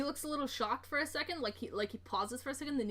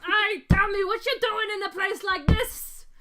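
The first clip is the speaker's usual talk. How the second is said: loud, higher dwarf voice; hearty dwarf; 10 semitones higher, 10 dB louder than usual. Acted. Dwarf leading his new brother to the bar, boisterous.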